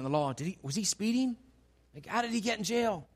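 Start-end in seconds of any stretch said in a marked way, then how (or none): none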